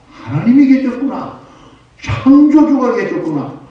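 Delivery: high, loud cry of discovery; acted amazed realization. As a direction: exclaim it as a sudden revelation, acted out. Acts scientist's awed discovery of God. (깨달음에 벅차 크게 외치는 연기)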